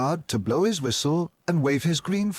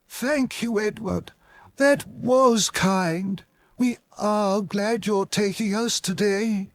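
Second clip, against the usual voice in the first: much higher grateful coach voice; pleased coaches; 7 semitones higher, slower than usual. Acted. The coaches thanking Edward, high pleased voice. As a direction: sound delighted and polite.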